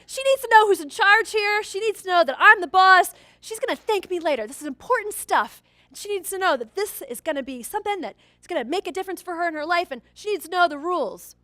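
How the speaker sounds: proud dad boasting he's in charge; smug dad. Retold or imitated. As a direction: gloat that you're the boss. Smug dad self-justifying.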